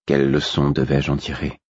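usual speaking voice

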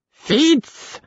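very high, energetic cry; high fool's exclamation; slightly cracked piping. A narrator's reading. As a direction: pipe Fitz out insistently, voice thin and cracking. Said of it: "d'une voix légèrement fêlée".

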